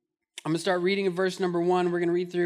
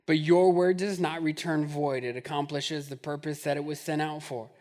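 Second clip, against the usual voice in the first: prayer; 5 semitones lower than usual. Acted. Opening prayer.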